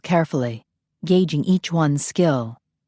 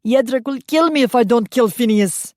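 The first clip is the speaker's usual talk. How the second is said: desperate plea; 7 semitones higher, 4 dB louder than usual. A narrator's reading. Stanislav desperate mid-duel.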